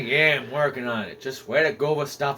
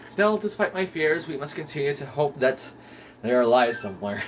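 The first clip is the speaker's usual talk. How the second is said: lower, solemn castaway log reading; journal narration; 4 semitones lower, 5 dB quieter than usual. A narrator's reading. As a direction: read the shipwreck diary with gravity. Reads castaway journal entry.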